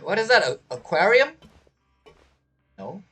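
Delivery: ordinary delivery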